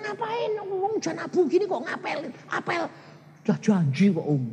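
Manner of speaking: put-on preacher teasing boy going on a date in ash rain; mock dialogue. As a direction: tease the lovesick boy. Preacher vs young lover dialogue.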